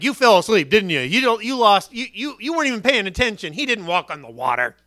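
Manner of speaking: loud scolding father; scolding dad. Retold or imitated. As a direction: tell the boy off, loud and mocking. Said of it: "The dad's gonna be like".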